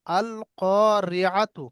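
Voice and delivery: very slow, each syllable drawn out; slow recitation. Shown as a demonstration. Teacher reciting the Quran word slowly for students. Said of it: "আর একটা দেখেন".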